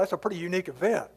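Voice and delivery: ordinary talk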